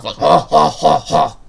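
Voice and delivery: loud mock villain "ha ha"; hammed-up cartoon villain laugh; evil laugh. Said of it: "how to do a proper evil laugh".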